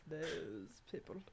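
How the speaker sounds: slow, half-whispered mock disdain; that disdainful tone. Shown as a demonstration. Host joking about bumper sticker wording, hushed mock contempt. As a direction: draw it out in a hushed, put-on sneer. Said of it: "you have to say it in that tone".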